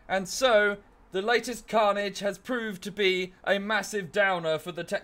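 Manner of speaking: newsreader voice. Retold or imitated. Mock anchor reading out the latest carnage, loud and high. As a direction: read it like a breathless TV anchor. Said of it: "That kind of newsreader voice".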